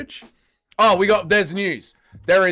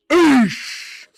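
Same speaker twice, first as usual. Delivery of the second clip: pained eesh; 9 semitones higher, 7 dB louder than usual. Real, not acted. Reacting to magpies mobbing hawk.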